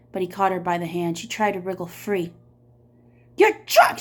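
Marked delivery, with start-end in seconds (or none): 3.4-4.0 high, loud accusing yell. Acted. Miss Catherine yelling at the drunk sheriff, high and loud. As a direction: throw it in his face. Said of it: "she yelled".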